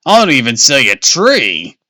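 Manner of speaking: loud, exasperated yell; screaming. A narrator's reading. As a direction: shout it in frustration. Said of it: "Dad screamed".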